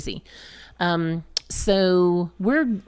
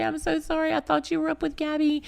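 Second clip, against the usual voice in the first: higher, teary, pleading sorry; tearful apology; 11 semitones higher, 4 dB quieter, faster than usual. Retold or imitated. Parent apologizing to found child, higher teary pleading. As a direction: apologize through mock tears, voice pitched up. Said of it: "I start crying".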